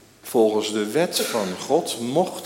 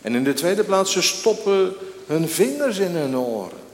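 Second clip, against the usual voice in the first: raised, high preaching voice; ringing pulpit delivery, building to a cry; impassioned preaching; 9 semitones higher, 4 dB louder than usual. Acted. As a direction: preach it loud, stretching the key words. Preacher dramatizes the mob's attack.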